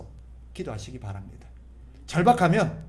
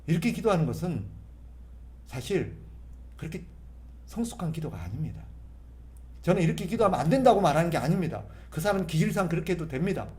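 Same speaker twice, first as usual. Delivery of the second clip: ordinary delivery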